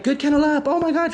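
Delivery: high, gushing puppy praise. Retold or imitated. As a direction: rave at the puppy. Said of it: "I would say like".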